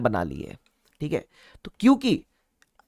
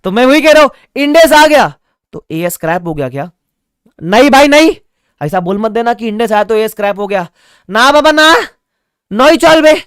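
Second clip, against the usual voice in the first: loud, higher, playful no-way protest; emphatic denial; 5 semitones higher, 10 dB louder than usual. Real, not acted. Teacher to students, loud playful denial. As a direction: shout it down like a big brother. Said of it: "teacher emphatically denies".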